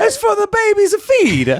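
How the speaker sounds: high put-on Italian man voice; mock Italian captain. Imitating the boat captain who shrugs at nudity, high hammed-up voice. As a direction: ham up the carefree captain.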